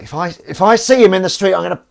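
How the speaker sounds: loud, high-pitched exasperated outburst; worked-up rant. Retold or imitated. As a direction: play up being fed up, loudly. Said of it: "I was like".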